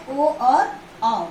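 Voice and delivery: ओ and औ drawn out slowly; slow recital of ओ and औ; long vowels recited. Shown as a demonstration. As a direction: stretch ओ and औ for the class. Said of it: "ये हो जाता है four".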